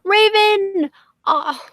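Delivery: high, loud wail of the name; dismayed cry. Real, not acted. Host calling to a friend who is leaving, dismayed cry. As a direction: call out in dismay.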